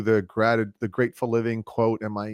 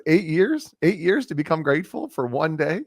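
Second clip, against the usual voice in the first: incredulous tease; 7 semitones higher than usual. Retold or imitated. Buddy teasing him on phone.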